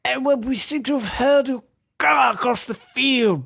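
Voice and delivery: British gent calmly reporting a hurled car; posh British accent. Retold or imitated. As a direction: play a flustered English gentleman. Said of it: "He just gets more British as he goes".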